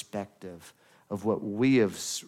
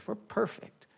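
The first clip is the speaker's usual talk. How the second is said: mock smug; 8 semitones higher, 6 dB quieter than usual. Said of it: "I'm not trying to be ugly".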